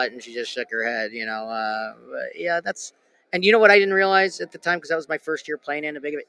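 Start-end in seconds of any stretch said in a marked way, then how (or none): none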